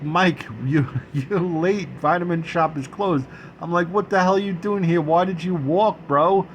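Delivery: mock-scold a friend in disbelief; exasperated scolding. Teller to his friend who walked in the rain, exasperated.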